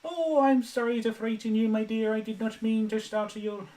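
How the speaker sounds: very high, put-on nanny voice; Missus Doubtfire. Shown as a demonstration. Streamer with a dry throat attempts the impression, high-pitched. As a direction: apologise in a high, loud character falsetto. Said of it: "an impression of, um, Missus Doubtfire".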